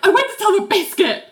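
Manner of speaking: quick, very high confession; high fast voice. Retold or imitated. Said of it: "and then she's like".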